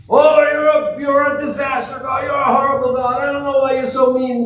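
high, loud whining shout at God; hammed-up complaint against a mean God; whiny accusing sinner.

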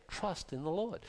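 hushed, gentle, lifting at the end; quiet sincere reminder; soft refrain. Real, not acted. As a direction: say it softly as a tender reminder. Repeated soft refrain of trust.

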